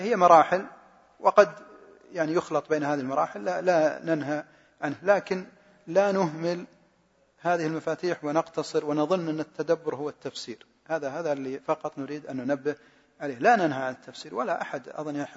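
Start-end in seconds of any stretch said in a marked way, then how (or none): none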